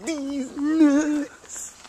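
goofy high-pitched joke voice; silly high voice. Pretend. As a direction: squeak the catchphrase out high. Catchphrase in a silly voice.

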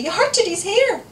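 slow, high, loud protest; screaming child. A narrator's reading. Sister accusing brother over the toy, high scream. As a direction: wail it out like an outraged little girl. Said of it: "screamed Ailey".

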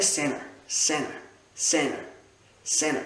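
"center" repeated in American English; American accent drill. Shown as a demonstration. English teacher showing learners how to repeat, American accent. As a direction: drill "center" American-style, like "senner", pausing between. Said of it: "on le répète plein de fois".

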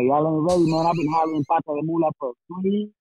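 ordinary delivery

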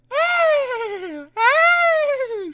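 high, loud, sustained two-blast toot; diesel horn toot. A narrator's reading. Daisy tooting out of the yard, high and loud. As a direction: imitate a horn with long high hoots. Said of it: "she tooted".

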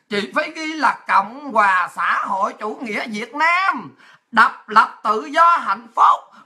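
drawn-out heading, phrase by phrase; slow formal dictation. Retold or imitated. Officer showing Lisa how to write it, slow formal dictation. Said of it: "phải xuống hàng đàng hoàng chứ".

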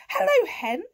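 high, loud, bright-pitched cat hello; chirpy cat greeting. A narrator's reading. Cat greeting horse in the field, high cat voice. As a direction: call out as the storybook cat. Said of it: "said Kat".

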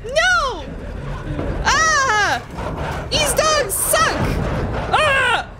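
high frightened yelps; high startled cries and groans; scared yelps. Real, not acted. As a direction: yelp in panic as the dogs attack. Scared of attacking dogs.

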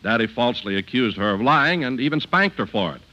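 normal talking voice

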